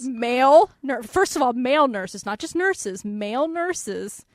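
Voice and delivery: MALE stressed hard in male nurses, loud then slow; emphatic disbelief. Real, not acted. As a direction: hammer the word MALE in disbelief.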